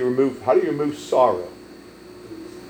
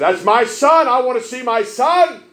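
raised high and loud, pleading; anguished father's cry; 6 dB louder, slower than usual. Retold or imitated. Grieving father demanding to see his son, loud and high. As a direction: cry out for the dead son, much higher and louder. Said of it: "And he said".

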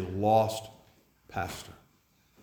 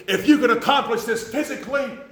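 much higher and louder, a pushy eager voice; urging young preacher; 9 dB louder than usual. Retold or imitated. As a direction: mimic the cocky young preacher pressing hard. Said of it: "he's just urging me on and on".

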